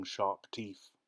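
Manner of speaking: plain reading voice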